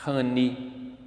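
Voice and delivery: normal talking voice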